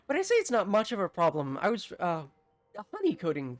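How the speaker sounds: babbling out of nervousness; mayor caught in a lie, flustered; nervous fluster. Acted. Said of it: "laughs nervously".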